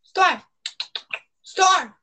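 calling out. Real, not acted. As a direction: call out across the room. Calling the dog.

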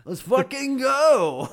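high, loud, drawn-out battle cry; hyped-up yell. Pretend. Host joking about a steroid cycle, pumped-up yell.

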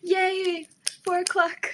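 high, loud happy cheer; yay it out, thrilled; excited cheer. Real, not acted. Cheering that drinking starts soon, excited.

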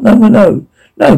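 panicked. Acted. Elevator crashing, panic.

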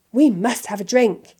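eager, loud, rising excitement; childlike thrill, building to a high call; delighted. A narrator's reading. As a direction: gush with growing fascination. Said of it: "said Mister Weasley delightedly".

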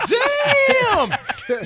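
sudden high shout of dismay; shocked damn. Retold or imitated. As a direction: yell it in disbelief. Shocked reaction to crack found.

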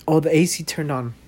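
normal talking voice

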